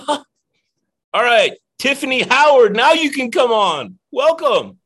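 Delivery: high, loud host announcement; excited welcome. Real, not acted. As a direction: bring the guest on with a big welcome. Host welcomes guest, calling out.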